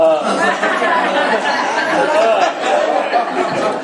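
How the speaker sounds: loud, high breathy burst of laughter; nervous laugh. Real, not acted. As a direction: laugh off the nerves. Nervous laugh, strict timer.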